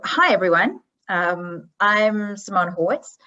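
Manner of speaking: ordinary delivery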